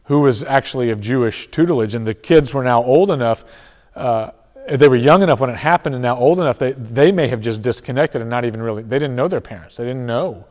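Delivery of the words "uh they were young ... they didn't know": normal talking voice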